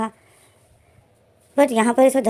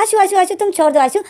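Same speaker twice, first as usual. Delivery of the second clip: calls the name over and over, much higher, in a rush; anxious repeated call of Aashu; hurried calling out; 8 semitones higher than usual. Acted. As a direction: rush in to stop him, pitch jumping up. Doctor rushes to stop breakfast.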